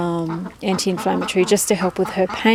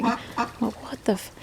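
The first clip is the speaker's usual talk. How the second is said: muttered disbelief; 3 semitones higher, 10 dB quieter, slower than usual. Real, not acted. Disbelief at wheezing dog.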